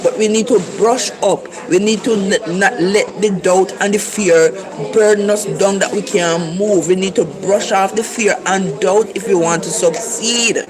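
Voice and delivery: ordinary talk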